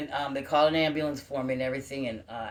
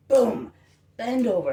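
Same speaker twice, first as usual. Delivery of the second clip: sudden loud burst, higher and louder; loud boom; 9 semitones higher, 10 dB louder, slower than usual. Retold or imitated. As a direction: slam out the fall as a loud boom. Collapsing in the story.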